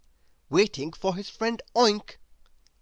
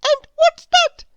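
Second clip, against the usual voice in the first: very high, loud bird cry; flying bird crying out; eager cry; 12 dB louder than usual. A narrator's reading. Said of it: "cried Chirp".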